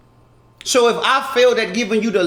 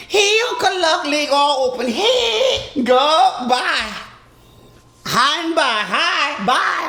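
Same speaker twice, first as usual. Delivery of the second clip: much higher, teasing woman's voice; mock flirty woman; 7 semitones higher, slower than usual. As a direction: play a flirty woman calling out to him. Mocking women greeting him.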